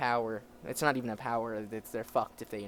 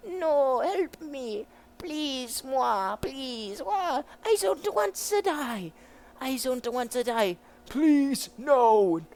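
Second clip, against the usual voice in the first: high, hammed-up cries for help; panicked begging; 13 semitones higher, 9 dB louder, much slower than usual. Prisoners begging not to die, mock panic. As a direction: wail for your life, theatrically. Prisoners beg for their lives.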